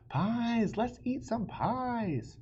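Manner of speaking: calling everyone to the feast; shouted. Acted. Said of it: "shouted Circumference".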